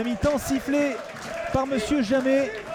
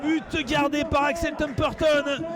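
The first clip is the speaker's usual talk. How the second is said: raised, thrilled replay commentary; excited sports commentary; 5 semitones higher than usual. Real, not acted. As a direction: gush about the goal. Co-commentator reacts to goal. (commentaire sportif enthousiaste)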